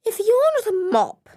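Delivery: high haughty snooty voice; sniffy, disdainful cleaner fish; posh fish voice. Acted. Snooty goby character speaks.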